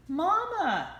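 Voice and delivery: high, loud call; crying out. A narrator's reading. Ducklings calling after their mama, high and loud. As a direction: shout for mama to wait. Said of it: "they cried".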